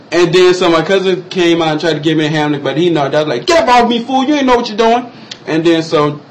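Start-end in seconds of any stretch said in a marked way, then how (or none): 3.5-5.1 high-pitched mock protest; annoyed retort. Choking man pushing off his cousin, indignant. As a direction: re-enact fending someone off, high and indignant.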